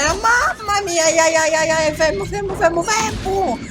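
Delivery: high rising panic; panicked. Pretend. Panicking as creature attacks.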